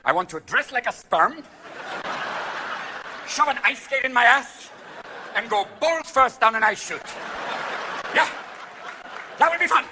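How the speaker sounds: hammed-up eager daredevil; excited luge inventor. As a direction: pitch it eagerly, drawn out. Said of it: "What guy went".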